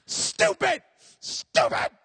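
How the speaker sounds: high, loud scolding shriek; furious sister. Retold or imitated. Sister beating brother, high furious shriek. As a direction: shriek at him while hitting. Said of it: "sister is proceeding just to beat".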